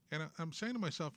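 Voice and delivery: usual speaking voice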